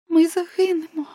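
voiced girl's high wail; crying out. A narrator's reading. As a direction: cry out high as if doomed. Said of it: "вигукнула Неле".

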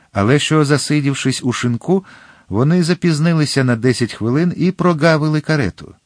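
ordinary delivery

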